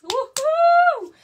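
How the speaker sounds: very high, loud "uhú" cheer; loud whoop. Real, not acted. Streamer cheering for the Mexico dates, high loud whoop. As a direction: let out a piercing whoop of excitement.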